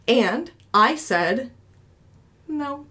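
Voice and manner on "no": tiny high-pitched, hushed denial; nervous, ashamed. Retold or imitated. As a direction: answer small and soft, too embarrassed to admit it. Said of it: "I was nervous and ashamed and embarrassed".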